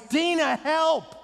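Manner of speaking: re-enacted yell, high and drawn out; mighty call for help. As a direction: holler slowly for your wife to come. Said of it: "I called out mightily".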